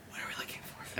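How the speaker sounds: unvoiced whisper asking the friends. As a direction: hush it so the cop can't hear.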